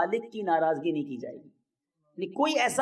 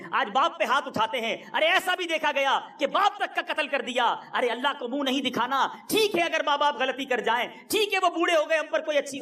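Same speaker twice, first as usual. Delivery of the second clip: fervent preaching; 6 semitones higher than usual. Real, not acted. Impassioned sermon climax.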